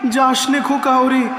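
high-pitched pleading call; anxious plea. Acted. Mother begging her boy not to go, anxious high call. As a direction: call the child back in alarm.

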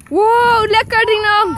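loud, high cheer for a stunt; excited cheer. Real, not acted. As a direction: shout it out in delight. (enthousiast gejuich)

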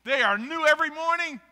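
slow, loud, rising scripture proclamation; verse recital. Shown as a demonstration. Preacher reading Lamentations to the church, slow and loud. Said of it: "I think it says".

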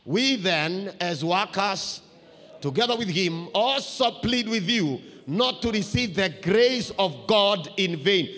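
full-voiced second reading; read again with force. Shown as a demonstration. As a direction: call the verse out to the church. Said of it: "Let's read once again".

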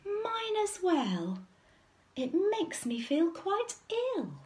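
high, dismayed bear mother; high-pitched wail of mild distress; Mummy Bear. A narrator's reading. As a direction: cry it out high and fretful. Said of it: "Mummy Bear cried".

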